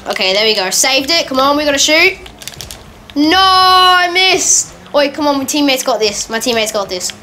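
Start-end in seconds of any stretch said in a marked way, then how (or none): 3.1-4.7 high, loud, drawn-out wail; dismayed cry. Real, not acted. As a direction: stretch out a loud cry of frustration. Misses a shot in game.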